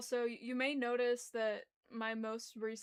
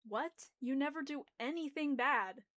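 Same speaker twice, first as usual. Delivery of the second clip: surprised, raised voice; exclaiming; 4 semitones higher, 4 dB louder than usual. Acted. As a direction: exclaim it in disbelief. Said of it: "Kat exclaims".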